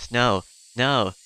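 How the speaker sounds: loud, frantic no, no; loud frantic refusal; panicked no. Acted. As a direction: cut the topic off in alarm. Panicked refusal about the uncle.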